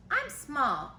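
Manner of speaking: usual speaking voice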